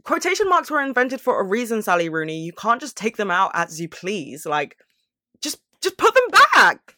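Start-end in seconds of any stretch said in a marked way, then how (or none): 5.4-7.0 high, loud cry of frustration; exasperated outburst, pitched way up; exasperated mock yell. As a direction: shout it up high at the absent author. Mock plea to the author.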